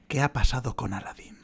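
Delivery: dismayed whisper. Real, not acted. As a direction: hush it in disbelief. Dismayed rhetorical question about film.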